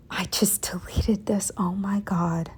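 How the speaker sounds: soft whisper of dismay; despairing whisper. Real, not acted. Realizing she deleted her work.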